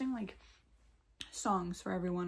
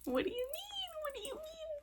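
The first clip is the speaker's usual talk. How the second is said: incredulous cry; 5 dB quieter than usual. Acted. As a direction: squeal it in mock disbelief, very high. Acted incredulous outburst at retold news.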